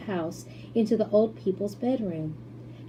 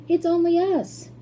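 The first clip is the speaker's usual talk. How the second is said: cheerful reassurance; 10 semitones higher, 5 dB louder than usual. A narrator's reading. Grandpa Joe reassuring.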